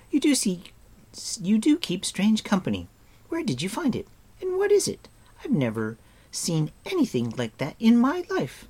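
pert, high-voiced young heroine; Eilonwy, astonished. A narrator's reading. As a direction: pitch way up like an amazed girl.

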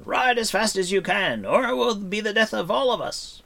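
high, loud, desperate warning; urgent shout. A narrator's reading. Bard urging Taran to ride for his life, shouting. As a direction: shout them on their way. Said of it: "the bard shouted to Taran".

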